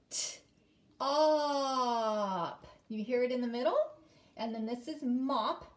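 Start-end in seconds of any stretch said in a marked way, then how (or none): 0.1-2.7 "T, op" with "op" held, louder; sound-by-sound split. Shown as a demonstration. Teacher segments the word sound by sound. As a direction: split top into its sounds, lean on op. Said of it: "do all the sounds in the word top".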